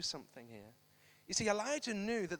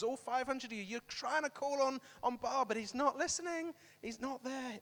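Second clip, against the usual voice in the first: higher-pitched mocking of the crowd; taunting; 5 semitones higher than usual. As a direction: rub in their failure mockingly. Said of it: "one guy taunting the five hundred".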